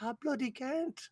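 high-pitched imitation British accent; mock British accent. Woman answering ridiculing family, high mock British accent. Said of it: "She's like".

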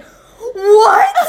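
high laughing disbelief; shocked what. Retold or imitated. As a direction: squeal it in disbelief. Shocked at missing flight.

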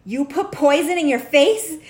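loud, slow, outraged question; a horrified husband confronting his wife; upset husband. Retold or imitated. Husband shocked at his wife's Botox, loud and outraged. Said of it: "He was so upset".

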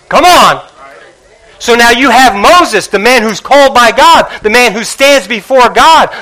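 loud, high, driving sermon climax; preaching at full force. Acted. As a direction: build it up and proclaim. Preaching climax, God's intent.

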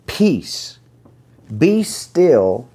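high, ringing command of Peace, be still; commanding rebuke. Acted. Jesus rebuking the wind and waves, high commanding call. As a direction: call out to the sea to stop.

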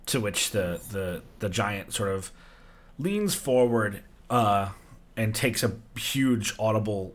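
ordinary talk, nothing marked

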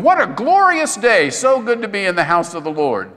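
high, sing-song fake cheer; mock cheerful. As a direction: chirp it like nothing is wrong.

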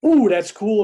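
high, loud burst of pretend wonder; mock excitement. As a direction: act out an excited "ooh". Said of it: "and I get excited".

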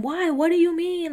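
high, pushy mock whine; sarcastic impression of a pushy friend pestering; mocking Drew. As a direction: needle her in a high, insistent whine. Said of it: "she kept saying".

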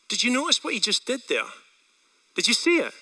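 usual speaking voice

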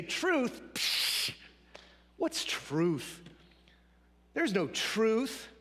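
scoffing, dismissive Pilate; lightly voiced sneering governor waving truth away; mocking dismissal. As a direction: brush truth off with a scoff, slowly. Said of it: "mockingly, disparagingly, dismissively".

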